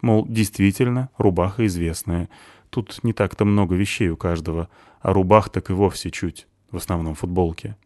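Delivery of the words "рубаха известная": ordinary delivery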